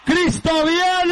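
high ringing cry, slow and drawn out; exultant shout. Acted. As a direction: proclaim it to the whole church. Preacher's climactic proclamation.

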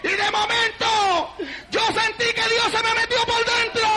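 high, long-drawn revival shouts; ecstatic preaching. Real, not acted. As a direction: cry out as if seized by the Spirit.

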